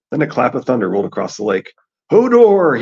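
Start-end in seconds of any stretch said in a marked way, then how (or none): 2.1-2.8 high, loud panicked roar. A narrator's reading. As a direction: roar it over the thunder. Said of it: "he roared".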